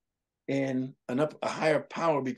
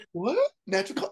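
higher, startled mock gasp; mock shock; 7 semitones higher than usual. Outsiders hearing about neighborhood deaths, mock shock.

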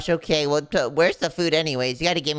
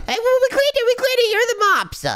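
flustered high excuse; 6 dB louder than usual. Acted. Caught by the guard.

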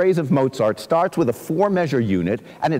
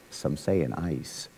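much softer and slower, low; quiet, slow; 10 semitones lower, 10 dB quieter, slower than usual. Shown as a demonstration. Teacher reading Frost softly to show the short line. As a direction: drop the line low and soft. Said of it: "here's what Robert Frost does".

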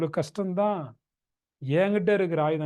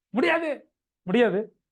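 loud, emphatic mock-aggressive no; acted vehement refusal, pitched up; 5 semitones higher, 5 dB louder, slower than usual. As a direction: slam the refusal down forcefully. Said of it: "ரொம்ப ஆக்ரோஷமா சொல்றீங்க".

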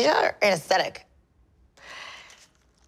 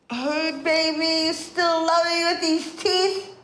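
sweet; 8 semitones higher, slower than usual. Real, not acted. Video message to Florian.

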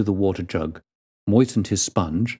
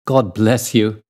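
loud, fervent blessing; loud, passionate exclamation; passionate cry; 5 dB louder, slower than usual. A narrator's reading. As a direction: cry it out with heartfelt gratitude. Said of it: "cried the prisoner passionately".

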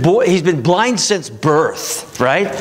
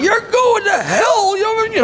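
slow, much higher hammed-up preacher yell; mock street preacher yell; slower than usual. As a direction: mimic a street preacher screaming at you. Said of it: "they're screaming".

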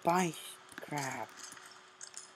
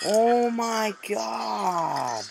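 high, slow, drawn-out cry; exasperated outburst; 9 semitones higher, 5 dB louder, much slower than usual. Real, not acted. Player losing it at the game, slow high exasperated cry. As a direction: drag the words out in frustration. Raging at an online match.

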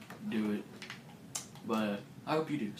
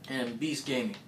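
ordinary delivery

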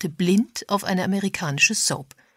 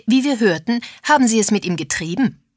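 higher, louder accusing tone; loud and brusque; 5 semitones higher, 7 dB louder than usual. A narrator's reading. Agatha accusing a woman of an affair, loud and brusque. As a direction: throw the accusation out bluntly. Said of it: "sagte Agatha laut und schroff".

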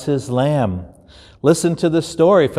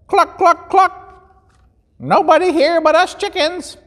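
much higher clucking hen voice; chicken voice. Acted. Chickens answering the searching shepherd, high clucking.

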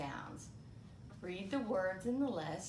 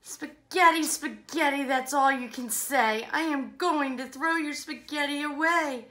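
mock-cross threat building to a high peak; fed-up outburst; 10 semitones higher, 16 dB louder than usual. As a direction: scold in exasperation, climbing to the last word. Fed-up playmate threatens to throw spaghetti.

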